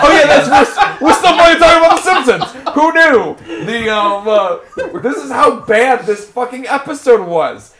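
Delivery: loud, high rant through laughter; exasperated outburst. Real, not acted. Host exploding at co-hosts over the bad episode, exasperated shouting. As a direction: blurt it out loudly, half laughing in disbelief.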